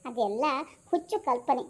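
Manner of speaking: ordinary talk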